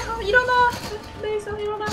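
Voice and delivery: high frantic cries begging him up; desperate pleading. Real, not acted. Begging downed teammate to revive.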